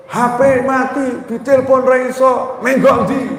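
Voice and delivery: a hint of a nagging wife snapping at the door; imitating angry wife. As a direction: play the fed-up wife grilling her husband, half-acted. Wife scolds her late husband.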